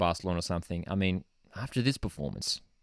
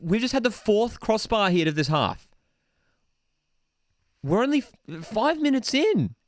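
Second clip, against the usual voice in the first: incredulous outburst; 10 semitones higher, 3 dB louder than usual. Real, not acted. Host reacting to fourth crossbar hit, incredulous outburst. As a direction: call it with escalating amazement.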